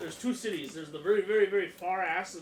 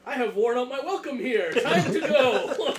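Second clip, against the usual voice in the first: louder and higher, a grand hammed-up farewell, last words drawn out with a laugh; dramatic exit line; 8 semitones higher, 12 dB louder, slower than usual. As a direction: sweep out like a showman leaving the stage. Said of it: "and go".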